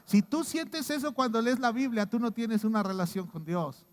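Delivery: ordinary delivery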